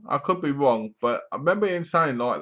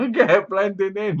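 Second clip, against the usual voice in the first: laughing; 6 semitones higher, 3 dB louder than usual. Real, not acted. Laughing at own pun.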